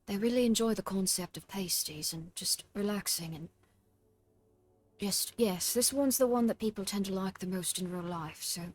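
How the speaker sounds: put-on very soft, upset British child; small British-accented child's voice, kept gentle. Showing a friend her favourite voice, soft British child. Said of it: "I like to do this one".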